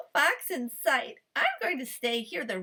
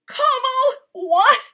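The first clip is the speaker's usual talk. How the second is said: much higher, louder spat words; spat in anger; 13 semitones higher, 13 dB louder, slower than usual. A narrator's reading. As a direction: spit out the disbelief. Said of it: "spat the farmer".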